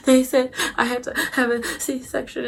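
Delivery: soft, high tearful whimper; small, high, mock-crying voice; started crying. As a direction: imitate whimpering to the doctor. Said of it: "started crying".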